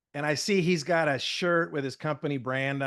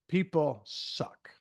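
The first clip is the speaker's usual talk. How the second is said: slow blunt verdict; much slower than usual. Retold or imitated. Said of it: "in two words".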